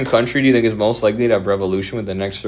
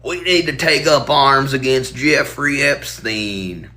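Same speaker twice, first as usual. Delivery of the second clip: mock American; 5 semitones higher, slower than usual. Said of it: "the Americans are like".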